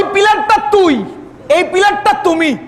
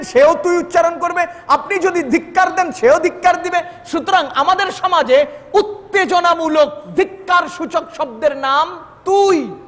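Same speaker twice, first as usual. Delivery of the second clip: ordinary delivery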